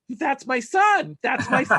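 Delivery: high, loud, drawn-out mock excitement; hammed-up proud announcement. Mom claims the gymnast on TV as her son, hammed-up excitement. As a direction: belt it out slowly like an overexcited mom.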